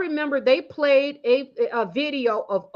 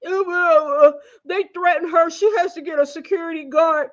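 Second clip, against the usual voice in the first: raised, louder mock whimper, imitating Oprah; mock Oprah crying; 4 semitones higher, 3 dB louder than usual. Mocking Oprah crying on CBS, raised, louder mock sob. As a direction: ham up a fake teary plea. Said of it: "Oprah was on C B S crying".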